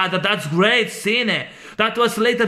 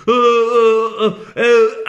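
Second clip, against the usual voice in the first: usual speaking voice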